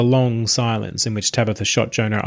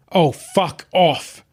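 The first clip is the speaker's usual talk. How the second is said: slow, stretched-out curse; shocked, drawn-out swear; 7 semitones higher, much slower than usual. A narrator's reading. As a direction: let the oath drag out. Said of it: "Tabitha swore".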